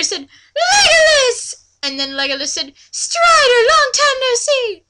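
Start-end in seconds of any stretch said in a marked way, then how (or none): 0.7-1.5 very high, loud call; Strider calling. Acted. Strider greeting Legolas with a very high shout. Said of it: "I'm going ever with the voices here". 2.9-4.8 very high, loud greeting; Legolas voice. Acted. Legolas greeting Strider in a very high, loud voice. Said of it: "I'm going ever with the voices here".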